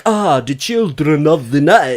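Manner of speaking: put-on slow Transylvanian vampire accent; Bela Lugosi. Imitating Bela Lugosi for podcast friends, slow vampire accent. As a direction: drawl it like Bela Lugosi's Dracula. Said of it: "a Bela Lugosi".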